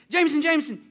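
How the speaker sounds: high, loud repeated name; calling to interrupt. Retold or imitated. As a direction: break into a child's chatter. Breaking into nephew's long list.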